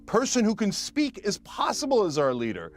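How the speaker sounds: high, wide-eyed amazement; amazed gasp. Retold or imitated. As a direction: play the stunned public. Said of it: "the American people were like".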